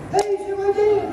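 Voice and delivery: high squeal of a fan calling out; excited girl calling. Retold or imitated. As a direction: imitate a girl's high-pitched greeting. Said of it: "girl running the projector's like".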